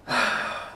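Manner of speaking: heavy audible sigh; weary sigh. Acted. Jonah about to own up to sailors, weary sigh. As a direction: breathe out a resigned sigh. Jonah groans before owning up.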